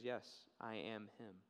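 low, slow, soft declaration; hushed, acted solemnity; quiet solemn reveal. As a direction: answer gently and deliberately. Jesus reveals himself, acted.

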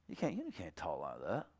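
soft, low, loving reproof; gentle correction. Acted. Showing how to confront Robert without hurting him, gentle correction. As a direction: correct him quietly, to restore him. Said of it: "I'm not coming at him hard".

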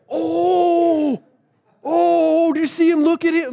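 high, hammed-up gossipy John; mock excited gossip. John pointing out Peter's shame, mock excited. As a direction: squeal it like a nosy onlooker.

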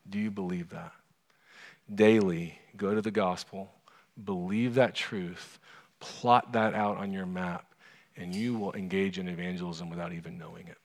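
ordinary talk

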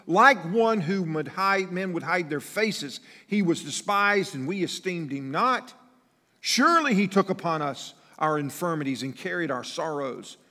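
loud, high, slowed sermon climax; fervent pulpit proclamation; preaching at full force. Acted. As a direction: build the verse into a shout. Preacher climbs in fervor.

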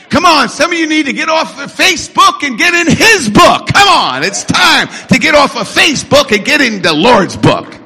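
high, loud exhorting shout; punchy revival-preacher exhortation; full-force preaching. Real, not acted.

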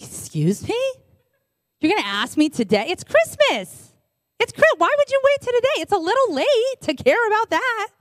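acted indignation climbing to a high pitch; mock livid. Livid cook to clueless spouse on Christmas morning, mock outrage. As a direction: play livid disbelief, voice shooting up. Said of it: "I would be livid".

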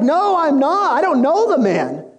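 high-pitched mock protest; acted denial. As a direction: deny it hotly, playing a cornered disciple. Peter denies knowing Jesus.

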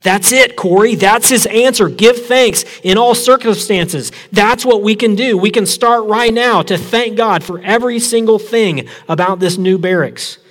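high and loud, thrilled insistence; excited. A narrator's reading. Betsy urging Corrie to give thanks, high and eager. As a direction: press it on her sister with eager joy.

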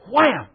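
punchy shouted wham; mock sword strike. Preacher voicing the sword blow with a punchy wham. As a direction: smack it like a blow.

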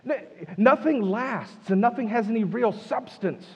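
high-pitched exasperated insistence; frustrated. Acted. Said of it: "Can you feel his frustration?".

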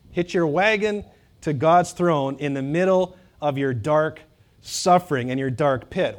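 usual speaking voice

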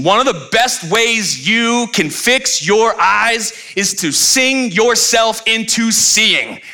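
high, impassioned preacher's build; full-force preaching. Acted. Pastor urges the church to sing, preaching at full force. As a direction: preach it out to the room.

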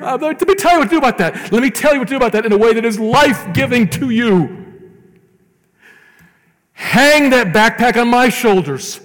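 high, loud, ringing divine promise; loud and soaring, God inviting you to hand over the burden; voicing God. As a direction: speak as God offering relief. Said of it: "And God says right here".

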